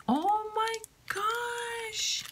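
slow, high-pitched squeal; long squeaky gush; delighted squeal. Real, not acted. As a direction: stretch it out high in delighted awe.

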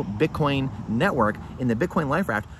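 normal talking voice

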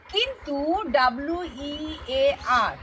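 normal talking voice

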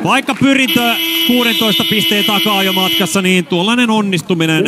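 real excited cheer. Real, not acted. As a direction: celebrate the basket. Commentator cheering a made basket.

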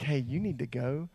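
hushed warning. Retold or imitated. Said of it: "but he was like".

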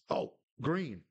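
high, rising excitement at a chance; excited cry. Real, not acted. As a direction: cry out as the striker breaks through. Chance on goal in game.

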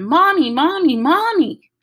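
put-on high, loud child's scream; frantic little girl screaming Mommy. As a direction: shriek for her mother in panic. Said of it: "ran upstairs screaming".

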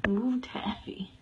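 soft breathy whisper, slow. Real, not acted. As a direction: whisper gently to the cat. Soft greeting to a cat.

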